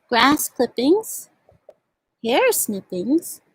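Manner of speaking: slow, sing-song picture-book reading; reading to children. Acted. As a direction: read each ingredient slowly, savoring the rhyme. Reads picture book aloud.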